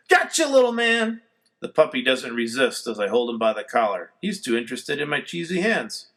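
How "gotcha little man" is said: triumphant. A narrator's reading. Catching puppy.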